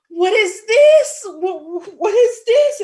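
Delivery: high, loud, stammering disbelief; retold shock, voice leaping up loud. Daughter finding mom's face on earners panel, shocked. Acts out shock at mother's photo.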